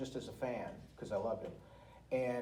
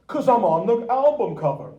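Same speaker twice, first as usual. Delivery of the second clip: proud punchline; 9 semitones higher, 15 dB louder than usual. Retold or imitated. Tony's punchline on stage.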